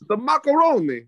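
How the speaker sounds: put-on Dexter's Lab accent. Friend mocking the actress's Dexter-like accent. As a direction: roll it out like the cartoon genius. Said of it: "she talks like Dexter from Dexter's Lab".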